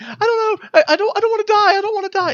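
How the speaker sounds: very high, fast, desperate; terrified girl. Acted. Sammy terrified as the crowd gathers, very high and frantic. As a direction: beg for your life. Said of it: "completely freaking out".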